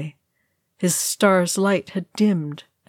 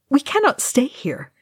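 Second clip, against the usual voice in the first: higher-pitched urgent warning; 8 semitones higher than usual. A narrator's reading. Legolas warning Aragorn to move on, urgent. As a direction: press the point with raised pitch. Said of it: "he said urgently to Aragorn".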